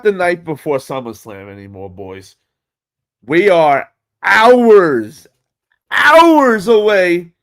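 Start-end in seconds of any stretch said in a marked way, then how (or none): 3.2-7.3 slow, louder, higher drawn-out hype call; hype build-up. Real, not acted. Telling viewers SummerSlam is near, slow loud hype. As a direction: stretch out the words to whip up the crowd. Hyping the coming event.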